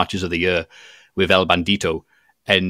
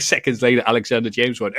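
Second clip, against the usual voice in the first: ordinary talk, nothing marked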